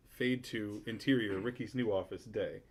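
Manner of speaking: normal talking voice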